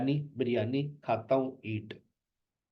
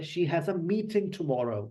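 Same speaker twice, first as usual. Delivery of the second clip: slow, stress on meeting; sentence stress; 3 semitones higher, much slower than usual. Shown as a demonstration. Teacher demonstrates sentence stress slowly. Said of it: "sentence level पर भी".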